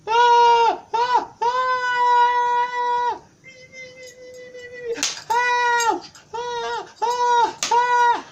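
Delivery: high falsetto mock crying yells; crying screams. Kid hit with the leather belt, high mock crying screams. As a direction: scream and sob like a whipped six-year-old. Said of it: "I was screaming top of my lungs".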